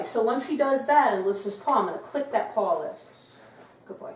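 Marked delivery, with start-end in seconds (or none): none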